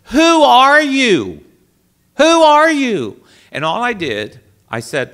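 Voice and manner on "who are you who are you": high, loud, slow tearful plea; sobbing question, high and loud; crying out. Retold or imitated. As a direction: beg through tears, pitch way up.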